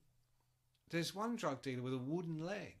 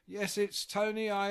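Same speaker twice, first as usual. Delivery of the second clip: higher, louder call into the intercom; imitating intercom call; 7 semitones higher, 8 dB louder than usual. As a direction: announce loudly to the gate speaker. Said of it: "you have to press".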